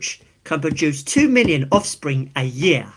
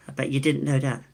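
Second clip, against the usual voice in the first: ordinary talk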